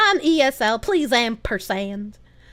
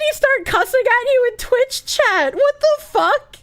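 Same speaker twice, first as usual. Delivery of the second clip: shocked; 10 semitones higher, 3 dB louder than usual. Real, not acted. Shocked at news of cussing.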